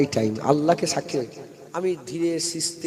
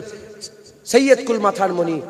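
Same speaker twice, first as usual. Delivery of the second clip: ordinary talk, nothing marked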